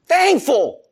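exclaimed. Real, not acted. Preacher exclaiming to congregation.

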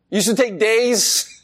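ordinary talk, nothing marked

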